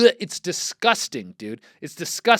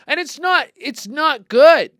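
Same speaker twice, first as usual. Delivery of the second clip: high, loud, slow protest; loud high outburst; 9 semitones higher, 7 dB louder, slower than usual. Real, not acted. Host protesting the chili to his cohost, loud slow outburst. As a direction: stretch it out in loud disbelief.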